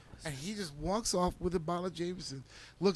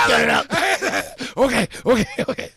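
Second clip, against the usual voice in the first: laughing surrender; 8 semitones higher, 13 dB louder, faster than usual. Retold or imitated. Giving up under choke, laughing.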